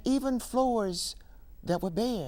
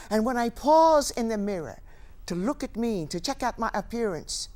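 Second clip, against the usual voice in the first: ordinary talk, nothing marked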